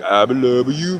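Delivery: high, loud cartoon character voice; Fat Albert voice. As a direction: imitate his odd cartoonish way of talking. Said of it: "he talks like, if you ever watch Fat Albert".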